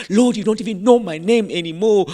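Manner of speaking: high, loud mock plea; frustrated prayer. Imagining you are praying to God, loudly frustrated. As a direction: wail it up to God, exasperated. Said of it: "in frustration".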